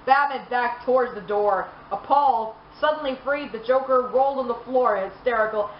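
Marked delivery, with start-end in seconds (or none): none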